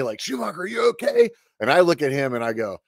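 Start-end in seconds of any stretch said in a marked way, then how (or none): 0.2-1.3 retold yell. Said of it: "So he yells at me".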